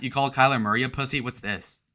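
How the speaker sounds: ordinary delivery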